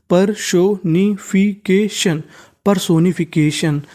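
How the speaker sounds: Personification spelt out in slow syllables: per-so-ni-fi-ke-shan; slow model word. Shown as a demonstration. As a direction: lay out personification slowly for students. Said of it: "तो meaning बन गई". (Personification धीरे-धीरे)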